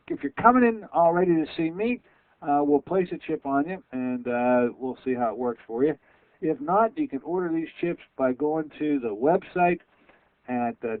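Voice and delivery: ordinary delivery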